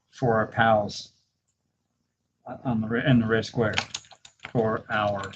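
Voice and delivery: slow, word-by-word dictation with long pauses. Real, not acted. Dictating a prompt to Gemini, slow and word by word. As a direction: spell each word out slowly for the voice typing.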